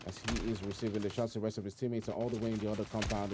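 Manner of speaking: brisk esports caster commentary, running call of the action; live play-by-play. Acted. Caster calling the match for stream viewers, brisk play-by-play. Casting a battle royale match.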